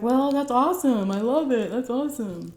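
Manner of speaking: hammed-up fake joy; fake-happy husband. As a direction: mimic his fake enthusiasm. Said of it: "he was like".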